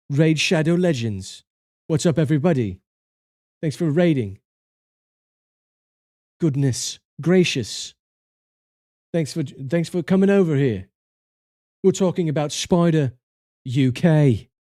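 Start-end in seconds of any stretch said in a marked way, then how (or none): 1.9-10.9 light mock British accent, welcoming raiders; a hint of a British greeting. Streamer greets raiders in a light mock British accent. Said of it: "I was doing a British accent". 13.6-14.5 lightly put-on British accent. Streamer names the raider in a light British accent. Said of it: "he's British. And so we're doing British things".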